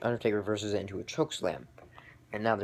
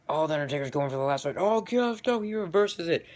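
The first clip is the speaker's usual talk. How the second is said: mock commentator excitement; 7 semitones higher, 4 dB louder than usual. Reliving the reversal as a fan, excited and high. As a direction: voice climbing much higher and louder, quick. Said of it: "it looks like".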